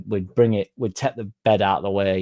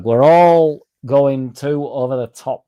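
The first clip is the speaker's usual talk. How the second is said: big hammed-up call; 4 semitones higher, 8 dB louder, slower than usual. Real, not acted. Hyping up the chat.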